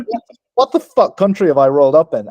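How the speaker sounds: loud, comically baffled outburst; exasperated disbelief. Retold or imitated. Newcomer stunned by the country, exasperated disbelief. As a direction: punch it out in mock bewilderment. His bewildered reaction to America.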